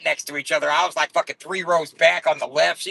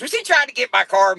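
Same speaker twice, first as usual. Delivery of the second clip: much higher imitated question; mock woman asking; 11 semitones higher, 4 dB louder than usual. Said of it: "She goes".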